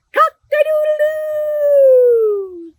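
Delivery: long, high, loud cock-a-doodle-doo; high rooster crow held long. Acted. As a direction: crow it out slow like a proud rooster. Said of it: "Wake up and shout".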